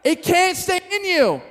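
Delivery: put-on high, very loud gleeful yell over vomiting kids; hammed-up victory cry; a young preacher cheering his own stunt; triumphant shout. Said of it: "and I'm like".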